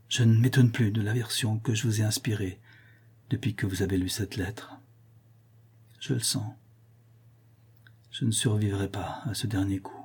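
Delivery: faint, fading to a whisper; barely voiced, drained of strength; weak voice. A narrator's reading. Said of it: "dit Sarah d'une voix faible".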